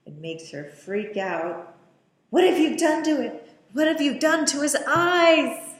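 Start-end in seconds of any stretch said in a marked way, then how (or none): 2.3-5.8 horrified outcry. Retold or imitated. Voicing Mia horrified at the baby.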